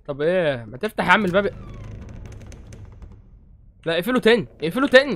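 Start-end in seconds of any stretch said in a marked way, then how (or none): 3.9-5.1 higher, louder close it again; panicked plea. Real, not acted. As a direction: scream to shut the door, scared. Panics at opening door.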